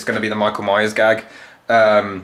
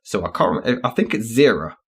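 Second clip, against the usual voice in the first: ordinary talk, nothing marked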